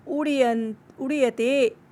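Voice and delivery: usual speaking voice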